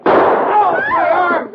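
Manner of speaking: sharp high yelp of pain over the arm; cry of pain. Acted. Arm hit by the sergeant.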